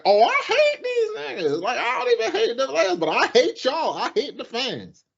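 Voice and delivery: high, worked-up rant against the rival fans; exasperated rant. Retold or imitated. Fan venting about rival Saints fans, exasperated rant. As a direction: blow up at them, fed up and ranting. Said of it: "I'm like".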